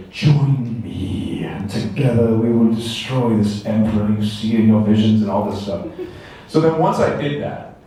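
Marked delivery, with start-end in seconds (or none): 0.1-5.2 drawn-out dark side villain voice; villain echo. Shown as a demonstration. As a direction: intone it as a grand, sinister invitation. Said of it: "you needed to say".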